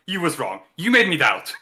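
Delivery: ordinary delivery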